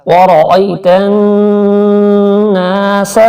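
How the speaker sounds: slow chanted tajweed recitation; Quran recitation. Shown as a demonstration. Teacher demonstrating recitation to students, slow and chanted. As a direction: draw out each syllable in melodic recitation. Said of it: "ہم یہ بتا رہے ہیں آپ کو یہ لفظ".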